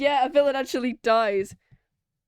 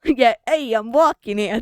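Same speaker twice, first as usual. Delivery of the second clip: loud New York "walking here"; New York accent; 6 dB louder than usual. Shown as a demonstration. Player repeating his guard voice to a friend, New York accent. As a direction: shout it like a Brooklyn cabbie. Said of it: "That's what I went with".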